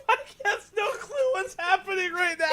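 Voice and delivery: very high squeal through laughter; words squeaked out while crying laughing; hysterical laughing. Real, not acted. Host pictures oblivious Mike, laughing hysterically.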